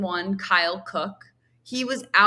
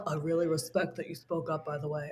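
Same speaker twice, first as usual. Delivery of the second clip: much softer, lower, mock-earnest flattery; a lightly voiced smarmy suck-up, murmured; a hint of a smarmy guy, said much softer; Tom Sandoval impression; 5 semitones lower, 10 dB quieter than usual. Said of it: "Tom Sandoval impression".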